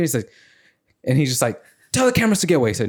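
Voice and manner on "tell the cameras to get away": higher, pleading demand; mock caught man. Caught man telling host to stop filming, higher. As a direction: whine at the host.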